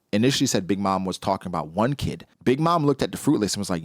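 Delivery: ordinary delivery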